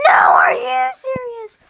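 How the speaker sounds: high, loud cry-shout. Acted. State kid screaming in the escalating argument. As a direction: scream it, voice cracking.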